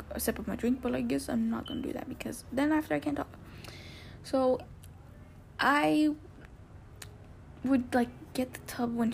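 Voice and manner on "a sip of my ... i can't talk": soft whispered aside; whispering. Real, not acted. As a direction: whisper it to yourself. Aside about drinking.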